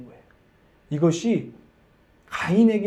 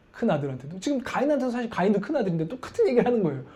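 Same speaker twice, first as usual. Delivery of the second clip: normal talking voice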